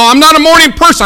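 high, loud grumble at being woken; emphatic protest. Acted. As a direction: protest loudly against mornings.

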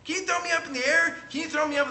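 put-on a child eagerly asking again, high; imitating kid. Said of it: "it's like".

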